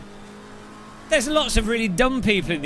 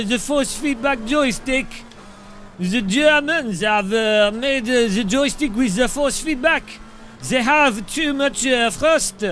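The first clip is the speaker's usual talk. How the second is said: cartoon French accent, slightly raised pitch; mock French accent. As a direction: play a flustered Frenchman in a jokey French accent. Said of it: "and then Thrustmaster be like".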